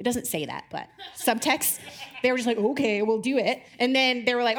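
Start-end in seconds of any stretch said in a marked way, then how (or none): 2.6-3.6 sarcastic. Retold or imitated. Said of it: "Maybe they sarcastically threw it".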